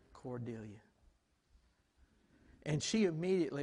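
ordinary talk, nothing marked

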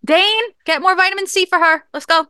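put-on high-pitched playful old-lady bossing; hyped-up cousins' voice. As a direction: put on a high mother-hen voice. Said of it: "that's the voice me and my cousins do".

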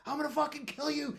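high-pitched retold threat; worked-up reenactment of losing it; enraged yelling. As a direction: yell it like you're being held back. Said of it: "was yelling at this".

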